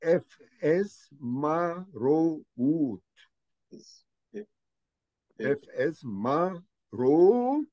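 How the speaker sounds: "F, S, ma, ro, ut" chanted syllable by syllable; read together slowly. Shown as a demonstration. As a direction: lead the class through each syllable. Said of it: "We shall read it now". ("ف، س، ما، رو، أوت" قراءة جماعية ببطء)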